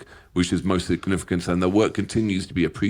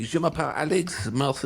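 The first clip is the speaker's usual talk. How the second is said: schoolbook French; 7 semitones higher than usual. Pretend. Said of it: "half French".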